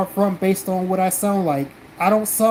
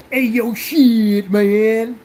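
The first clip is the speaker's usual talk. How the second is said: slow, drawn-out put-on street voice; mock Tyrone; 2 semitones higher, 4 dB louder, much slower than usual. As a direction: put on an exaggerated "Tyrone" voice, stretching the words. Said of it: "want me to sound like fucking Tyrone".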